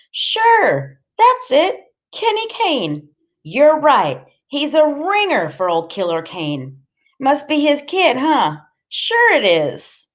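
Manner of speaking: high, loud dawning realization; wide-eyed, high-pitched agreement; delighted recognition. A narrator's reading. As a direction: exclaim as it clicks into place. Eyes go wide, recognizes the boy.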